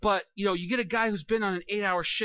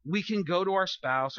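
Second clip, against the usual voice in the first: usual speaking voice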